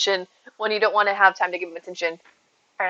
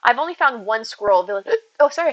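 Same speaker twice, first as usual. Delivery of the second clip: usual speaking voice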